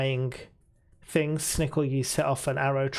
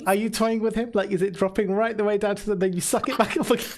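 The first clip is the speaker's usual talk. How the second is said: laughing; 6 semitones higher, faster than usual. Real, not acted. GM laughing at toying idea.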